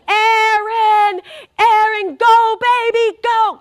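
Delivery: high, loud cheering holler; yelling. Retold or imitated. Husband cheering his son on from the balcony, yelling. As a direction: belt it out like a dad cheering from the stands. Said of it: "He's yelling".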